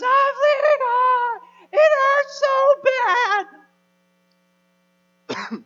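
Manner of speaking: piercingly high, very loud imitation of a hurt child; hammed-up crying shriek; screaming child. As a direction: wail each word out long and loud. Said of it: "the screaming and the crying".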